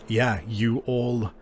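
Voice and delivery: ordinary talk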